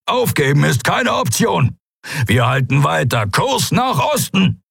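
high, strained captain's order; defiant command, voice pushed high; growled defiance. A narrator's reading. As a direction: growl defiantly, pitch driven up hard. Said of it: "knurrte er".

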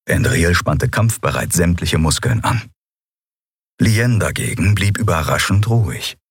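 ordinary delivery

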